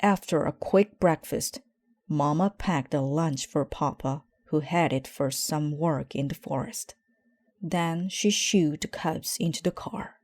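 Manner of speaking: usual speaking voice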